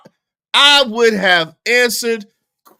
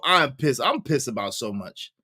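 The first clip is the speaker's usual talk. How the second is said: ordinary talk, nothing marked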